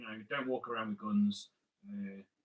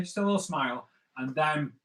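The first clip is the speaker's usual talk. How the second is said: normal talking voice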